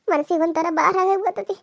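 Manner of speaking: usual speaking voice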